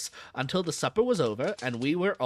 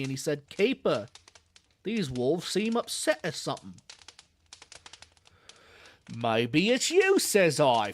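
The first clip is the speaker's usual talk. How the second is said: put-on old London zookeeper in a Cockney accent. Zookeeper chatting to a visitor, Cockney accent. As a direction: drop the aitches, Cockney keeper at ease. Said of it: "This guy is such an accent".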